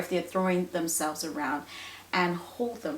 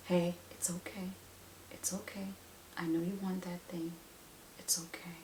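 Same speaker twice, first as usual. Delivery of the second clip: soothing whisper; calm; 14 dB quieter than usual. Shown as a demonstration. Parent shows how to soothe a tantrum, calm whisper. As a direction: whisper it gently to settle a child. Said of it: "like this, calmly, calm face".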